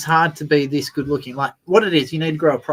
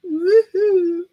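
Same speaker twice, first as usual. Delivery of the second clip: excited whoop; 3 dB louder than usual. Real, not acted. Mic finally comes on.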